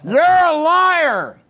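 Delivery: high, loud, drawn-out accusing yell; mock-outraged shout. Fan objecting to the predicted Bills loss, mock-outraged shout. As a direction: yell it in comic outrage, stretching it.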